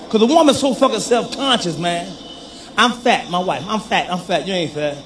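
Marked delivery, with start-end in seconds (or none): 2.8-4.5 self-conscious wife. Acted. Acts out self-conscious wife lamenting. 4.5-5.0 reassuring husband. Acted. Husband reassuring wife in acted exchange.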